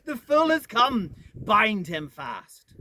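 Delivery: loud, slow triumphant witch cry. Acted. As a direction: crow it out loud over the captured lion.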